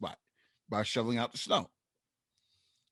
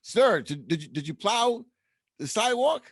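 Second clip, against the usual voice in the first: fake polite; 8 semitones higher, 3 dB louder than usual. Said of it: "I had a smile on my face".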